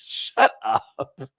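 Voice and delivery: laughing, mock outrage; laughing shut up. Real, not acted. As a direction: gasp it out through a laugh. Laughing at the comparison.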